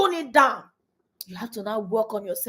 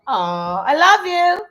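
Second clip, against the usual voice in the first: affectionate goodbye; 7 semitones higher, 8 dB louder than usual. Real, not acted. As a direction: send your little one off lovingly. Mother says goodbye to child.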